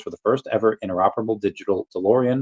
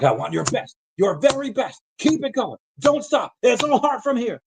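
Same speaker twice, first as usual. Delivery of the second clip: much higher mock coach yelling encouragement; hammed-up football coach pushing his team, pitched way up; imitating the coach. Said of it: "And the coach is like".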